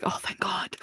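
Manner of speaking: mock breathless whisper of relief. Lucien spared from the spikes, imitated relieved whisper. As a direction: whisper it like a narrowly spared victim.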